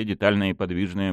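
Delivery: ordinary delivery, nothing marked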